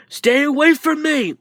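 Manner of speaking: high-pitched strained cry; frantic warning cry. Acted. Out-of-control fighter warns All Might off, frantic cry. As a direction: cry out to keep them back.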